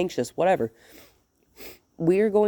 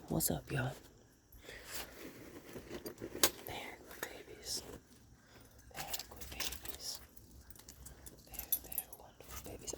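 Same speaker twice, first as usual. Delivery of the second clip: very soft, whispered fondling talk; hushed dog talk; 3 semitones lower, 21 dB quieter than usual. Real, not acted. Trainer to her dogs over treats, hushed. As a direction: murmur it under your breath to the dogs.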